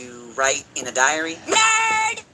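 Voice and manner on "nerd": mocking. Mocking diary writer.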